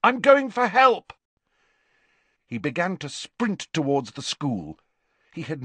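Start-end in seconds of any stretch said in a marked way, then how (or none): 0.0-1.1 loud high-pitched urgent call; shouting. A narrator's reading. Harry leaving friends to fetch help, shouting. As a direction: yell over the roaring wind. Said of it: "shouted at the others over the howling wind".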